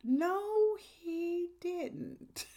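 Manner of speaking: slow, high mock denial as God; playing God. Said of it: "I'd be like".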